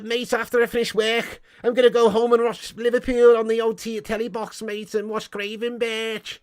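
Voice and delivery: high-pitched mock Scouse; Scouse accent. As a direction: put on an exaggerated Scouser. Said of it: "but good accent".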